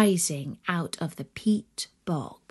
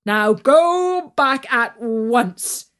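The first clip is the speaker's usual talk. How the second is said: loud mocking farm master, scornful; jeering master; 6 dB louder than usual. Farm master jeers at his worker, loud and scornful. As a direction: sneer it down at him like a boss. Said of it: "the master jeered at him".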